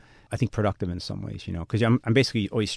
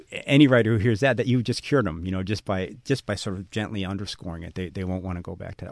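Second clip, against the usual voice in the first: ordinary talk, nothing marked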